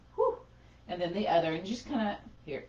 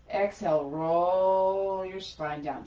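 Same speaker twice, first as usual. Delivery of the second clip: drawn-out and louder, stretched long; slow guided cue; 5 dB louder, slower than usual. Acted. Yoga teacher guiding the class down, slow drawn-out cue. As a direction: draw every word out like the spine rolling. Cueing slow exhale movement.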